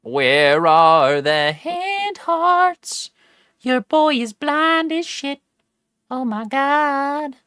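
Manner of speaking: very high, slow cartoonish whine; squeaky whiny voice. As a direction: whine it way up high, dragging each word. Mock whiny complaint at chat.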